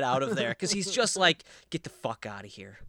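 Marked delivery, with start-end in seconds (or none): 1.7-2.8 very low and soft, dead serious; sincere contempt. Acted. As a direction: imitate a husband who truly wants her gone. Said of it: "fucking sincere".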